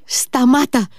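high-pitched cry to stop; old woman shouting. A narrator's reading. Old woman calls after fleeing Mitya, shouting. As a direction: call out shrilly as an alarmed granny. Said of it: "άρχισε να φωνάζει η γριούλα".

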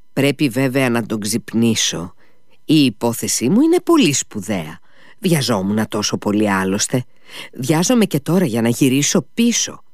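usual speaking voice

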